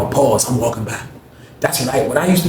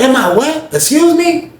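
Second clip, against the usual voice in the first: much higher, shocked and prissy; Greg's high offended voice; 13 semitones higher, 3 dB louder, slower than usual. Retold or imitated. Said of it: "He was like".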